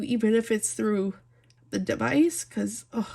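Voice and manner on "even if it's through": normal talking voice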